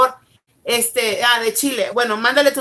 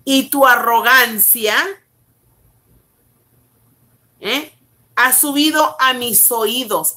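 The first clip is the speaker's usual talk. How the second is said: preaching; slower than usual. Acted. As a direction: weigh every word. Reads scripture slowly.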